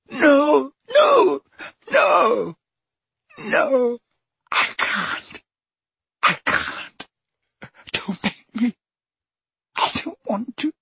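high cries fading to broken pleading; screaming in terror. A narrator's reading. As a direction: scream no, then beg. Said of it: "Dumbledore screamed".

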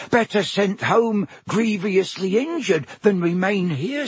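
high, eager elf squeak; house elf voice. Acted. Elf answering Harry, high squeaky voice. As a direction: pitch it up like a nervous house elf. Dobby voice.

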